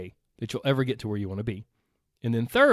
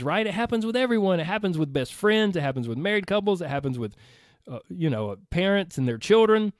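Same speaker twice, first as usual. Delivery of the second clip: normal talking voice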